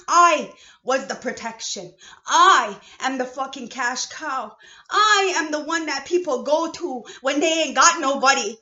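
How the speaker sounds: raging shout at the audience; furious rant. Real, not acted. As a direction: yell out the hurt of being used. Furious rant about being unprotected.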